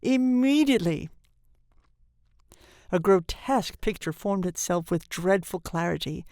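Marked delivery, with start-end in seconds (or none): 0.0-1.1 higher, emphatic single word; insistent. A narrator's reading. Nurse presses the father to go, insistent. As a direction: insist on it sharply. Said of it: "insisted the nurse".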